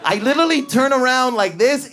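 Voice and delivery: normal talking voice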